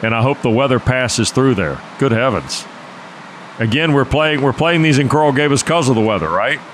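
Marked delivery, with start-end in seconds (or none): none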